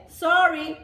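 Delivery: loud, high sorry. Acted. Herd rejects their leader, loud sorry. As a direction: dismiss him firmly.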